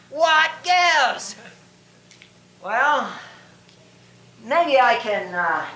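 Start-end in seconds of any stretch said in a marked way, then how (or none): 0.1-1.3 higher, drawn-out mock outrage; theatrical bafflement. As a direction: throw up a hammed-up puzzled question.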